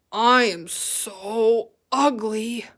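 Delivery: slow put-on pig voice, glum; a pig moping about his looks; sad pig voice. Gilbert voiced in story.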